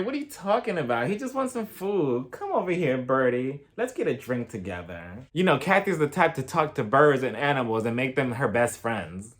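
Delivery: ordinary talk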